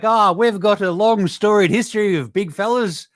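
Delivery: lightly voiced, proud of a bad history; mock pride in big men; proud team exec. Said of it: "It's like".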